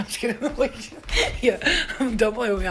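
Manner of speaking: normal talking voice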